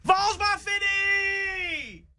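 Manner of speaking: very high, long-held fan holler; rallying cry. Real, not acted. Fan signing off before game weekend, high drawn-out cheer. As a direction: stretch it into a high victory whoop.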